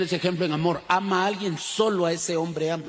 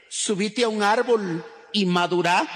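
normal talking voice

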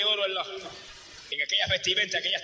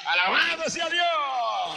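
slow, higher, stretched-out cry of praise; ecstatic shout; 6 semitones higher, much slower than usual. Retold or imitated. Believer greets death with a drawn-out, higher shout. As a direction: shout it slowly to heaven.

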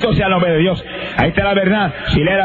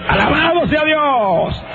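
slow, much higher, ringing praise; exultant praise; 9 semitones higher, much slower than usual. Real, not acted. Preacher praises God before the congregation, slow exultant cry. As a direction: stretch it out in triumph.

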